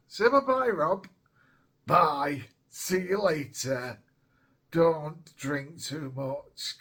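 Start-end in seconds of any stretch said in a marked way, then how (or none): 0.1-4.0 playful toy voice. Pretend. Voices toy robot saying bye.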